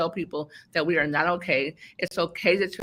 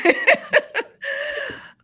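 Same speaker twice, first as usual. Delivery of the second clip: high burst of laughter; 6 dB louder than usual. Real, not acted. Joking she's done.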